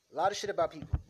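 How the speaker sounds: ordinary talk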